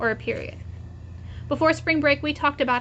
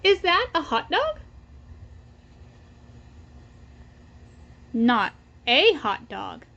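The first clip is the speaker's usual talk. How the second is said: put-on reading to children; 5 dB quieter than usual. Teacher reading the Pigeon book aloud to her class. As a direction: read it out for little ones, pausing for them to echo.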